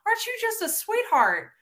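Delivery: very high, gushing Southern coo; patronizing sweetness. Acted. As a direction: gush with patronizing fake affection. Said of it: "a lot of people get confused and they're like".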